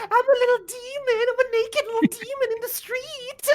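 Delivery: very high, squealing little demon; Quip yelling. Acted. Game master plays familiar making a commotion, yelling. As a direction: shriek it comically in a tiny creature's voice. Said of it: "he's just yelling".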